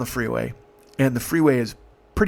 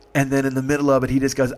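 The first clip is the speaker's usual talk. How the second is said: usual speaking voice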